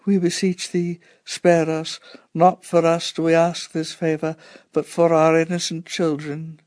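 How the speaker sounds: high, pleading prayer in short phrases; moaned prayer. A narrator's reading. Two women praying through the air raid, moaning pleas. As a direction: beseech heaven in a sustained high moan. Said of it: "the two of them moaned their pleas".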